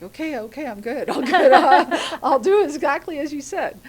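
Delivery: high, loud, laughing through the words; nervous giggly promise to obey; flustered laughing agreement. Retold or imitated. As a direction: blurt out yes while cracking up. Retold own flustered reply to doctor.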